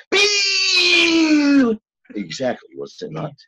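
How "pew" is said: high, loud sci-fi zap shouted; alien ray gun sound. Retold or imitated. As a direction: blast out a cartoon alien laser noise. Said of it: "this is what they would sound like".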